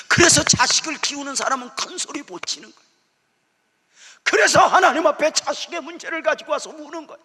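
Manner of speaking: high, loud preaching cry; impassioned preaching. Acted. Pastor shouts to parents in the congregation, impassioned.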